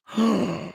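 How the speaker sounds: sudden sharp gasp of shock. Real, not acted. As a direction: gasp as if hit by bad news. Shocked by news of lawsuit.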